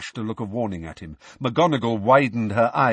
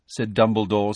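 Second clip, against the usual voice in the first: ordinary delivery, nothing marked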